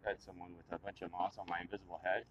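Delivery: usual speaking voice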